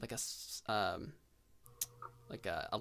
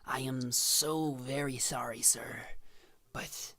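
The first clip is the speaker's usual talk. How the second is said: hushed apology. Acted. Doctor gently breaks bad news.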